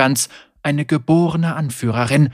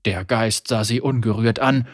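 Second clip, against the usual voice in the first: ordinary delivery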